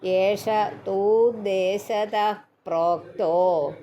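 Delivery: തൂദ്ദേശത with a lengthened തൂ; ഏഷ തൂദ്ദേശത with a long first vowel. Shown as a demonstration. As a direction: hold the തൂ in തൂദ്ദേശത, never short തു. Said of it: "തൂ നീട്ടുണ്ട്".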